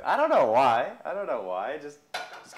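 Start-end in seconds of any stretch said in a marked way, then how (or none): 0.0-0.9 put-on playful Southern accent, said loudly. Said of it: "makes me talk in a southern accent".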